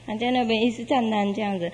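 ordinary delivery

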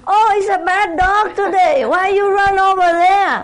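high, singsong scolding of a naughty dog; tut-tut the dog in a high voice; mock-scolding neighbor. Neighbor scolding her dog, high and singsong.

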